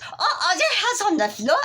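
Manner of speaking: put-on very high, giggling French accent; laughing French stylist. French stylist laughing about the hair on the floor. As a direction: laugh it off lightly. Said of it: "she goes".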